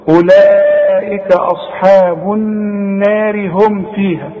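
slow chanted tajweed recitation of the verse; تلاوة مجودة بطيئة; Quran recitation. Shown as a demonstration. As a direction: recite it slowly, each letter held. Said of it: "شوف".

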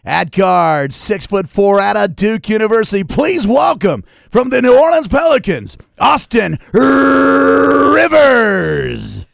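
high, loud and slow arena intro; PA announcer voice. Pretend. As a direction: boom it out to a packed arena. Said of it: "can you do that in your P A announcer voice".

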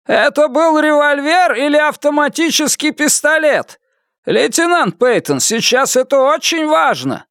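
high, urgent voice through static; over the radio. A narrator's reading. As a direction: push the words through a crackly radio. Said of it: "Голос его был невыразительным и далёким".

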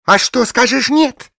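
high, loud and indignant; fly up at him in a high voice; flaring up. A narrator's reading. Bleached blonde snapping back at the lumberjack, high and loud. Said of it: "взвилась Пергидрольная".

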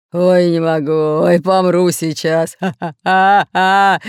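loud, slow, laughing through words; helpless ticklish laughter. Acted. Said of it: "смеялся рыжий капитан Буль-Буль".

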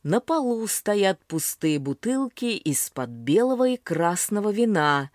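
ordinary delivery

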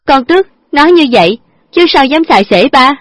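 high, loud protest; upset retort. A narrator's reading. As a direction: insist she means no disrespect. Daughter defends herself.

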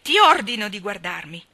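very loud, stressing guardami; loud angry demand, words hammered; furious commands. A narrator's reading. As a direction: order him to look, in rage. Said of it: "urlò con rabbia".

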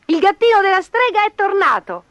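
put-on high, loud child voice; excited children. A narrator's reading. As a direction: announce the news like thrilled kids. Children announce the kitten to dad.

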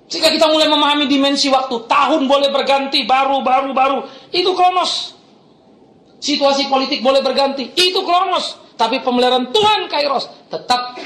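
high, emphatic pulpit delivery; impassioned preaching. Real, not acted. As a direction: build each contrast to a high-pitched peak. Preaches at full force. (khotbah tegas berapi-api)

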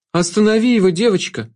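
high, urgent plea; high-pitched entreaty; pleading shadow. A narrator's reading. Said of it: "взмолилась тень".

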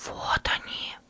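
sharp, urgent whisper. Acted. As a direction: point them out under your breath. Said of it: "прошептал он".

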